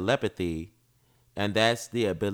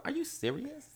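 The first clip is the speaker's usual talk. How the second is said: much higher, disbelieving squeak; incredulous squeal; 11 dB quieter than usual. Real, not acted.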